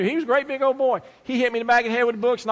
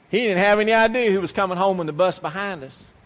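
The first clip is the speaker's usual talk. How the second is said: ordinary talk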